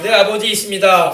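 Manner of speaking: slow, deliberate declaration; measured call-and-response lead; leading a repeat-after-me. Shown as a demonstration. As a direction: stretch it out for them to echo. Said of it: "따라합시다".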